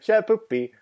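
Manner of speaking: high silly mock word; silly quote. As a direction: toss out the cartoon gag brightly. Quoting Family Guy gag.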